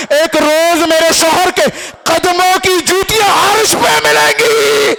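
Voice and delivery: sermon oratory rising to a loud, high-pitched peak; impassioned preaching. Acted. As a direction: build to a ringing, stretched-out climax. Preacher builds to climax.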